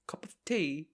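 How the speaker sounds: a hammed-up British accent asking "Cup of tea?". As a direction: play it like a cartoon Brit offering tea. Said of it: "change from Southern to British".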